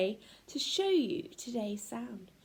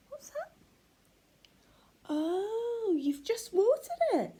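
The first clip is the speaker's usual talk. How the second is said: mock surprise; 9 semitones higher than usual. Surprised discovery for children.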